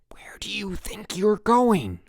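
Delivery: slow, furious whisper; angry whisper. A narrator's reading. Counselor catching campers sneaking out, angry whisper. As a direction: whisper like it's a shout. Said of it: "it sounded like shouting".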